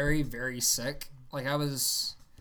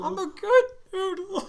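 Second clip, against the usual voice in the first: cartoon kid voice; 4 dB louder, slower than usual. Repeating a cartoon line.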